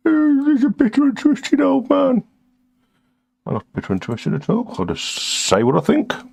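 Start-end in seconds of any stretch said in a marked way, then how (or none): 0.1-2.2 much higher, prissy mocking voice; mock viewer voice. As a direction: ham up a snooty critic. Voicing a viewer's jab.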